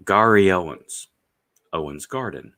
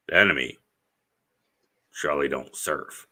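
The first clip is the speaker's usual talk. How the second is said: lightly voiced colonel; 4 semitones lower than usual. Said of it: "and they're like".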